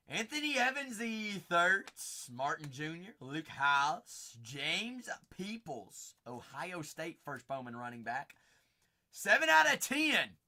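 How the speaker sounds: ordinary talk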